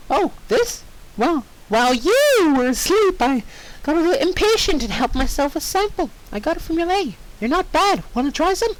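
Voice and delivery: high, bubbly pony voice; high chirpy cartoon pony; cheerful Pinkie. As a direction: offer it sweetly like a cheerful pony. Pinkie offers meat.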